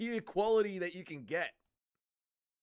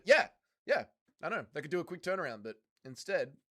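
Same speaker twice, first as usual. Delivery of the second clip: ordinary talk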